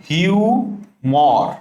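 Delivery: হিউমর drawn out very slowly; slow spelling drill. Shown as a demonstration. Teacher showing students how হিউমর sounds, slowly. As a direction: stretch হিউমর out syllable by syllable. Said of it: "হিউমর বানানটা তুমি জানো".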